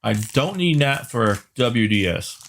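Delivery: talking while crunching chips; eating chips mid-sentence. Real, not acted. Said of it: "Can you hear me crunching on these?".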